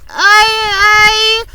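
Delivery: very loud, high frantic cries; panicked shouting. A narrator's reading. Earthworm frantically shouting refusal, very loud and high. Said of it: "cried the earthworm".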